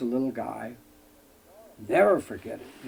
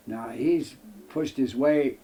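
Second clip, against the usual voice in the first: ordinary talk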